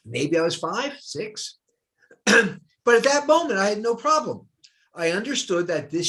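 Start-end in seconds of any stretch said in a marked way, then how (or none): none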